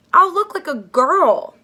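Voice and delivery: high, loud, slow indignant protest; boy horrified at dressing as a girl, loud protest. Acted. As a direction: wail it in outraged disbelief. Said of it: "he protested".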